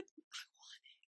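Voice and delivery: breathy whisper, slow and hushed. Retold or imitated. Quoting the rival's sly line, whispered. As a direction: let it out as a secret whisper.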